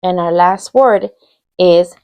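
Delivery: ordinary talk, nothing marked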